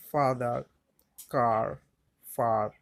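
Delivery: ফাদার, কার, ফার each with an open "aa" vowel; A pronounced "aa". Shown as a demonstration. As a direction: say ফাদার, কার, ফার as separate model words, the A as "aa". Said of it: "এর উচ্চারণ আ হয়". (ফাদার, কার, ফার-এ A-এর উচ্চারণ "আ")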